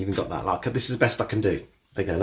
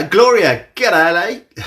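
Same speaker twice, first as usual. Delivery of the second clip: Aussie g'day, pitched up and loud; Australian accent; 7 semitones higher, 8 dB louder, much slower than usual. Pretend. Greeting a viewer in a mock Australian accent. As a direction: greet her like a cheery Aussie. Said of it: "I'm in Australian again".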